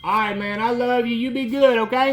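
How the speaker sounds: loud, high friendly call. Real, not acted. Preacher shouts birthday wishes to a friend outside, loud and warm. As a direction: shout happy birthday across the yard. Calls goodbye across yard.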